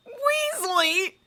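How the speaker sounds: high, loud outburst of disbelief; shocked exclamation. Acted. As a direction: blurt the name out in shock. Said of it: "Severus exclaimed".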